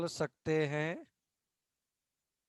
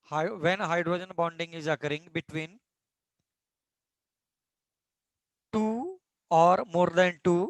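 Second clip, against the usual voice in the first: slow, even dictation pace for notes; 4 dB louder, much slower than usual. Acted. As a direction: spell it out slowly so students can write. Teacher dictating again. (धीमी गति से लिखवाना)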